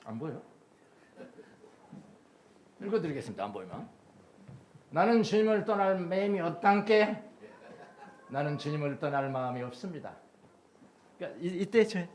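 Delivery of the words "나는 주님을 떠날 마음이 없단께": Jeolla dialect ending 없단께, higher and louder. Shown as a demonstration. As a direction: read the prayer line like a Jeolla local. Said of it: "표준말로 썼습니다".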